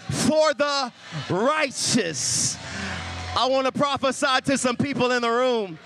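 loud, high, driving sermon climax; full-force preaching. Acted. Preacher prophesying to the congregation, loud and high. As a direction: build up and preach it hard to the room.

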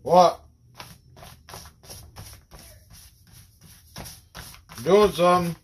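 loud high call to someone offscreen. Real, not acted. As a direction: shout back across the room. Calls out loudly to someone.

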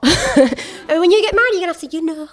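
mock northern accent. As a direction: put on a northern English accent, pitch up high. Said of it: "she didn't have a northern accent".

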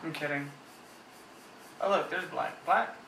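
ordinary talk

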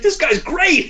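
high, loud impressed cry; imitated amazed admiration. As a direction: rave like an impressed audience member. Imitating impressed reaction to his comeback.

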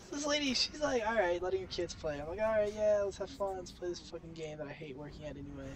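ordinary delivery

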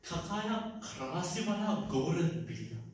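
low, soft mourning; subdued lament. Acted. Speaker mourning a once beautiful city, low and soft lament. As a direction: sigh it out quietly, low in the voice.

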